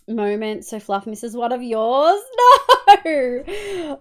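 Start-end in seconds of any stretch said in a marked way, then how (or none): 3.0-4.0 helpless laughing outcry; laughing protest. Real, not acted. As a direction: burst out laughing on the word. Teased about missing a moment.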